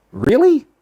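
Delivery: genuinely taken aback, pitch jumps up; surprised. Real, not acted. Fishing streamer reacting to a thousand-dollar rod, surprised.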